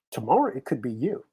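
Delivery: ordinary talk, nothing marked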